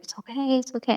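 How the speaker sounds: gentle acted reassurance; retold emotional soothing. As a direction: hush an upset child tenderly. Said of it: "she emotionally soothes me".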